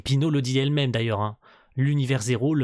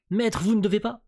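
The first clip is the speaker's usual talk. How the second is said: high-pitched pleading servant voice; alarmed protest; 9 semitones higher, 3 dB louder than usual. Acted. Manga character warning the master not to act, high urgent protest. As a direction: raise the pitch in urgent objection.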